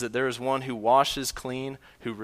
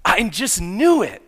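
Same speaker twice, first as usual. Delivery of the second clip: put-on high, loud burst of a child's excitement; imitated gleeful shout, pitch leaping up; excited yelling; 6 semitones higher, 9 dB louder than usual. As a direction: act out a thrilled little girl yelling in triumph. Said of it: "jumping up and down and yelling with excitement".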